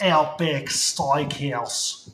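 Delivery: slow, loud mock Aussie accent. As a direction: ham up an Australian voice. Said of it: "Aussie accent".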